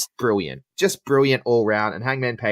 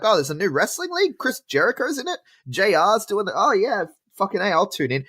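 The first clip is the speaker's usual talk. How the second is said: excited casual fan; 8 semitones higher than usual. Retold or imitated. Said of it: "and I'm like".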